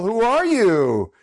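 high, drawn-out cheeky question; mock-nosy singsong; wise guy. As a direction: stretch it out like a kid playing receptionist. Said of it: "being a little bit of a wise guy".

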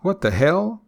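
"hell" climbing high as a startled question; stress on hell. Shown as a demonstration. Reacting to a TV that stopped working, rising stress on "hell". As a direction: dip on "the", then lift "hell" sharply. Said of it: "emphasis at the end on the word hell".

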